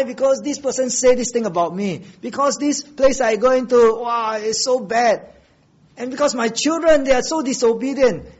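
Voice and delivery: high-pitched mock grievance; imitated angry complaint. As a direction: act out someone complaining about everyone else. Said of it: "I'm angry".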